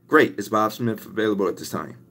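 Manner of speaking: soft, quick cold-call patter; cold-calling a dental office, soft and quick; phone sales pitch. Shown as a demonstration. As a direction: rattle off the pitch smoothly and politely. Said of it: "kinda how it would go".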